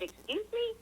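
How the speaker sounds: high-pitched, rising "excuse me?"; taken-aback retort. Retold or imitated. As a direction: retell being taken aback, pitch leaping up into a question. Taken aback by rude caller.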